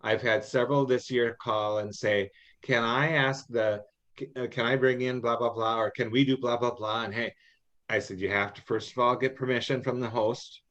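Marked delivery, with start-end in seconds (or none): none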